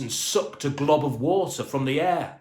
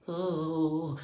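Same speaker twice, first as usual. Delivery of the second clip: dazed thought; 4 semitones higher, 4 dB quieter, slower than usual. A narrator's reading. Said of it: "he thought".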